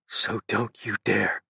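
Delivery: slow, faint hush; barely a whisper. A narrator's reading. Dying Ben warns Tenzin, barely above a whisper. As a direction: let it barely leave the lips. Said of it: "His voice was barely over a whisper".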